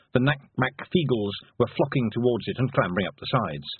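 ordinary delivery

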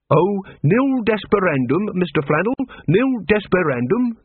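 breezy encouragement with a lifted pitch; cheerfully. A narrator's reading. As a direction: cheer him up, stretching the phrase. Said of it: "said Carrot cheerfully".

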